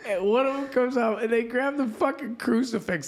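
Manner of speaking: high-pitched incredulous rant; worked-up retelling. Real, not acted. Comic telling sister's crucifix stunt over dying mother, worked up. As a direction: rattle it off high and scandalized.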